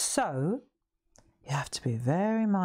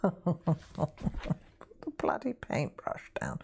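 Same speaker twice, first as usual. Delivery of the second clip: slow, hushed whisper of the order; whispered repeat; much slower than usual. Retold or imitated. Repeating the teacher's posh order to viewers, slow whisper. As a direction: breathe the line slowly as an amused aside.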